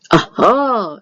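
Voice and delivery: loud triumphant cry; loud, delighted exclamation; excited aha. A narrator's reading. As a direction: burst out with glee. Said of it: "said Big Anthony".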